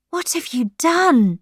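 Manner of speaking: high, accusing cry; cried out. Acted. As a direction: cry out in horror at her. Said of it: "she cried".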